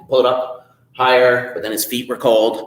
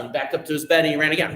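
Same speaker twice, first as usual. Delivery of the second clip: usual speaking voice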